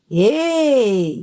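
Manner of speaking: enraged yell. A narrator's reading. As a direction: stretch the angry call right out. Enraged woman lunges at him.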